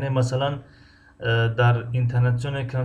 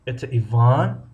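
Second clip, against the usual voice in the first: Иван stretched out with the pitch climbing at its end; slow, rising finish on Иван; 5 dB louder, slower than usual. Shown as a demonstration. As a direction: draw out Иван and lift it like a question. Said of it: "охири ҷумларо мо зиёдтар талаффуз".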